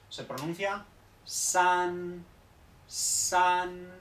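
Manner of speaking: "san" lento, con tono tercero. Shown as a demonstration. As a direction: alarga "san" con la s clara. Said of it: "para que signifique tres en chino". ("san" en tercer tono)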